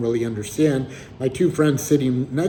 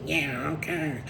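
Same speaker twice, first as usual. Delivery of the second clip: put-on a mild Southern accent, mumbled and soft; 3 semitones higher, 8 dB quieter, slower than usual. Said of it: "It was like a Southern accent".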